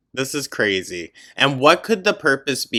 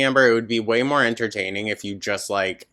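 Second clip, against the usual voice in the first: normal talking voice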